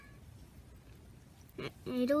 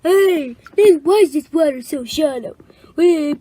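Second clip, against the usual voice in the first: loud, high indignant calling; shouting toy voice; 3 semitones higher, 13 dB louder than usual. Pretend. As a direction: yell it out for the toy. Voicing the scuba toy.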